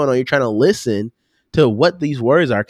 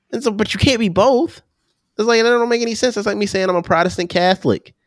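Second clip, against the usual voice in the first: high-pitched, baffled objection; worked-up, high-voiced disbelief; incredulous protest; 8 semitones higher, faster than usual. Real, not acted. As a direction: argue that it makes no sense. Protests a contradiction in terms.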